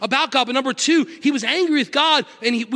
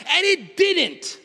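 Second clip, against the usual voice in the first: high, driving preacher's build; fiery sermon delivery; preaching at full force; 6 semitones higher than usual. Acted. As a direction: preach it up to the congregation. Preaching at full force, raised pitch.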